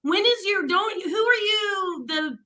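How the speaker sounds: usual speaking voice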